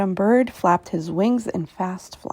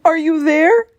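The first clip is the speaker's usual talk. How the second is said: louder and higher call into the clover; 6 semitones higher, 5 dB louder than usual. A narrator's reading. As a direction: call out searching for tiny friends. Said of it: "searched them and called".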